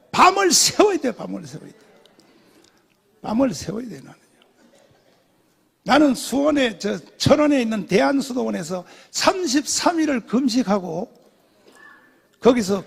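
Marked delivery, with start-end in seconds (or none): none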